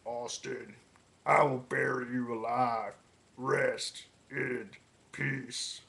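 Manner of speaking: slow graveside threat; a lightly voiced Undertaker. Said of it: "First with The Undertaker".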